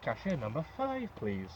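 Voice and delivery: tannoy announcement, drawn out on five; store announcer. Shown as a demonstration. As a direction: call it like a shop PA through a mask. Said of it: "I'll do it properly".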